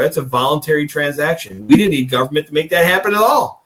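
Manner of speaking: ordinary delivery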